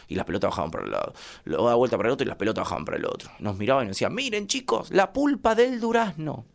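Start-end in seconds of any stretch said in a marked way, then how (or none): 4.1-6.4 high, slow motherly lesson voice; mother lecturing. Retold or imitated. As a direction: drag it out like a patient mom explaining. Mamá alecciona a los hijos. (madre explicando, aguda y lenta)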